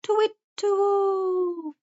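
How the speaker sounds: high, drawn-out owl hooting. A narrator's reading. Old wise owl calls over the woods, hooting. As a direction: hoot it like an owl, long and high. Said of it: "he hooted".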